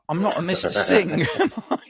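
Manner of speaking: laughing, pitch jumping high on "am I"; laughing disbelief. Retold or imitated. Patient to porter, incredulous laughing retort. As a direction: laugh at the absurd mix-up.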